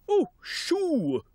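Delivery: shooing. Acted. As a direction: shoo the bird off. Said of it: "said the king".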